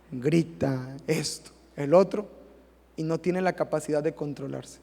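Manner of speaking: normal talking voice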